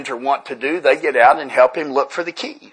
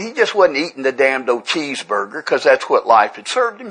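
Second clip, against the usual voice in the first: usual speaking voice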